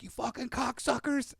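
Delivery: mock furious yell; hammed-up cussing dad; dad screaming. Dad screaming at sons in the barn, mock yell. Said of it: "he's just screaming at us".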